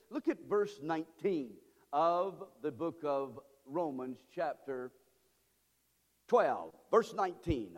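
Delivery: slow, low and deliberate; slow right down to guide them to the page; measured, low-pitched delivery with long pauses. Real, not acted. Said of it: "we're gonna go a little slower".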